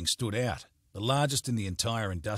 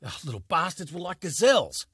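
exasperated; 8 semitones higher, 6 dB louder than usual. Acted. Frustrated after chase.

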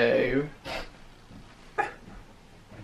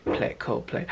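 whispered to self; 9 dB quieter than usual. Retold or imitated. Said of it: "I was like".

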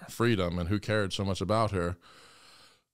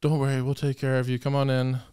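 higher, sugary coaxing; mock gentle escort; 5 semitones higher, 3 dB louder than usual. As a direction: imitate their sweet-talk. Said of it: "so gentle and kind with her".

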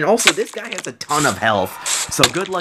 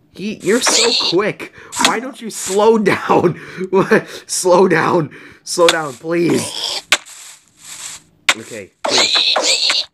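pleading; 6 semitones higher, slower than usual. Real, not acted. Begging a fast mob.

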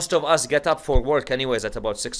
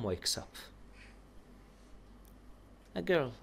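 hushed mourning narration; 3 semitones lower, 5 dB quieter than usual. A narrator's reading. Preacher softly telling mourners the tragedy, hushed and grieving. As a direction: draw them into sorrow quietly.

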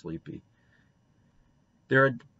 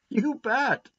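"You bet" pitched very high and firm; emphatic; 3 dB louder than usual. A narrator's reading. Boy agreeing with Anne, "You bet" high and emphatic. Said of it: "said Davy emphatically".

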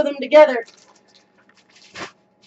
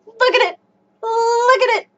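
each repeat higher, ending in a laugh; building excitement; 8 semitones higher than usual. Real, not acted. Showing viewers the skirt, rising giddy excitement. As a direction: climb higher with joy each time.

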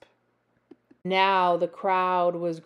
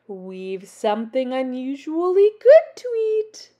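loud call climbing higher; 4 semitones higher, 8 dB louder than usual. A narrator's reading. Witch inviting everyone to eat, calling out. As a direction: build up in pitch and volume. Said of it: "called Naggy Witch".

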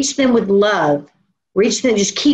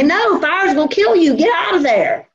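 urgent warning; 7 semitones higher than usual. Acted. Acted arm warning of danger.

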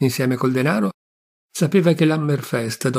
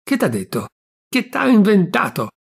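heated pressing; 6 semitones higher than usual. Acted. Venerina presses her uncle about the stranger, heated and rising. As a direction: press him hotly, climbing. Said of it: "incalzò Venerina tutta accesa".

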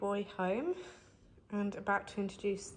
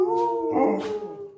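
high, loud delighted gasp then a squealed oh; excited gasp; 12 semitones higher, 9 dB louder than usual. Acted. Owner gasps at the dogs, high and loud. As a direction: gasp like a thrilled puppy.

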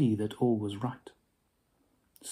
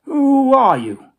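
put-on much higher, louder old miser; demanding Scrooge; 12 semitones higher, 10 dB louder than usual. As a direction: demand it of the ghost.

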